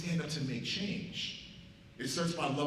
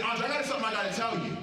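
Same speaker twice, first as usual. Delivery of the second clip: fast, louder and higher; pressing, loud, quick announcement; urgent call out; 7 semitones higher, 4 dB louder, faster than usual. Retold or imitated. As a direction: grab his attention across the desk. Confronting angry Andre in office.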